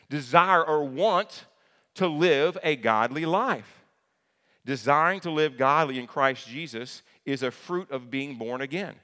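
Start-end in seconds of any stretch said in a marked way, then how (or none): 0.1-7.0 loud, slow, higher preaching; "desire" slowed and hammered out. Acted. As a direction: drive it home from the pulpit. Preaching climax.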